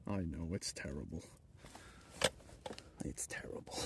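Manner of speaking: soft low then whispered; mock regret. Streamer agreeing with chat, whispered mock regret. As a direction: drop to a whisper, mock sorry.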